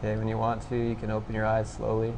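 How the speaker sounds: soft, low-key meditation guidance with long pauses; guided meditation. Real, not acted. Instructor guiding listeners to notice thoughts and sensations, quiet meditation voice. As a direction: gently prompt them, leaving long silences. Leads a meditation after breathing exercise.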